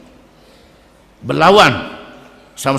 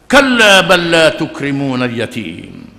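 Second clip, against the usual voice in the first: slow, drawn-out Arabic chant; measured melodic recitation; Quran recitation; 2 semitones lower, 4 dB louder than usual. Acted. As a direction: intone the verse like tilawah. Quran verse recited in sermon.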